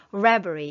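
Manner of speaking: Reverie with the first syllable REV punched; stress on the first syllable. Shown as a demonstration. Teacher modelling the word for students, first syllable stressed. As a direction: lean hard on the start of Reverie. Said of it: "앞에 강세가 있습니다".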